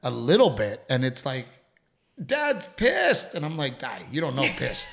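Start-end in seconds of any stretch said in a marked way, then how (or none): 2.2-3.3 very high-pitched mock kid voice; alarmed child. Dad imitating his son's reaction to him, high mock alarm.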